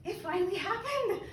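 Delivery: high, giddy disbelief; mock-exasperated joy at finally hearing it; telling her husband, high and thrilled; retold excited outburst. Retelling her overjoyed outburst.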